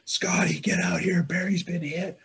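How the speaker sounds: high, loud urgent call to a friend in the cave. Retold or imitated. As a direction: call him out fast, someone's hurt. Calling Scotty out of cave.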